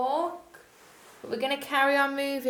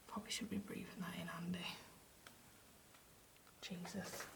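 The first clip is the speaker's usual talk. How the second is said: whisper; 4 semitones lower, 19 dB quieter, faster than usual. Real, not acted. Quick whisper near the mould.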